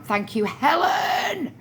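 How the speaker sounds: drawn-out, high shout on the name; calling out thanks. Real, not acted. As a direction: sing out a big, stretched thank-you.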